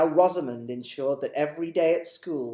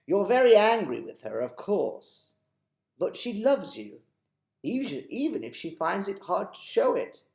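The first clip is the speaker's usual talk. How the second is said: put-on higher, louder old man, reassuring; a lightly voiced old tramp comforting her; kindly old tramp; 7 semitones higher, 7 dB louder than usual. Mister Stink reassuring Chloe.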